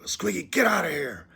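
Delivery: loud, high angry yell; upset shout. Retold or imitated. Owner ordering Squiggy out after dinner spilled, upset shout. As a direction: shout it at a pet to clear out. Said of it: "so I got upset".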